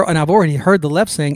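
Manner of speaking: usual speaking voice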